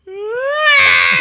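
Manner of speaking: sudden loud, high-pitched mock wail; exaggerated little-brother tantrum scream; imitated screaming cry. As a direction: shriek like a kid bawling on cue. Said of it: "he just starts screaming and crying".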